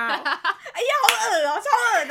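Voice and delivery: high, slow drawn-out mock disgust; teasing mock-grossed-out whine; playful disgust. Real, not acted. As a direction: squeal it like you're grossed out for fun.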